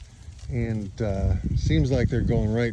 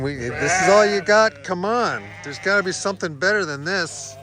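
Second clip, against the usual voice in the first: higher voiced sheep complaint; voicing the sheep; 5 semitones higher, 5 dB louder than usual. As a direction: speak for the demanding sheep. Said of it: "they're saying".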